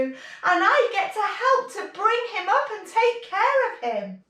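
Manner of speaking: put-on high, loud storytelling to kids; animated story voice for children; reading to children. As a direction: tell it big and bright for little ones. Kids yoga story narration.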